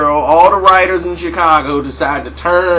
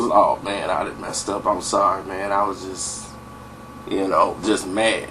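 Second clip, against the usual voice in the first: much lower, softer apology; sheepish Scotty; 8 semitones lower, 7 dB quieter than usual. Retold or imitated. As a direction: hang your head and mumble sorry.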